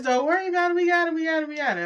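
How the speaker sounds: high, bright-pitched calming voice; retelling the contractor calming us down, high and reassuring; imitating reassurance. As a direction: mimic them soothing you. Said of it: "reassuring us".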